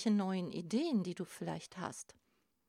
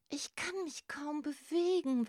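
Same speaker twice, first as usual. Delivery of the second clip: mild, higher-pitched whimper; tearful; 7 semitones higher than usual. A narrator's reading. Young crab to the circle after moulting, mildly tearful. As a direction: plead as if close to tears. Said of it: "weinte Grasper".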